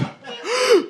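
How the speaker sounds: high shocked gasp. Retold or imitated. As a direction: suck in air like a startled crowd. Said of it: "Everyone goes".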